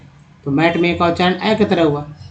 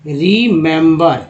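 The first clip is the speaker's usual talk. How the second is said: Remember said slowly, every syllable clear; slow careful model; 4 dB louder, much slower than usual. Shown as a demonstration. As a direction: stretch out remember so students hear each sound. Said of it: "इसका उच्चारण actually क्या हुआ". (Remember को धीरे-धीरे साफ़ बोलना)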